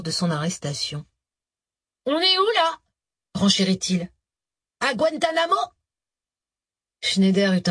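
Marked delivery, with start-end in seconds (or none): 2.1-2.8 put-on high, insolent street kid voice; cocky young rapper. A narrator's reading. Rapper mocks the cops. 4.8-5.6 put-on high, insolent street kid voice; cocky young rapper. A narrator's reading. Rapper mocks the cops.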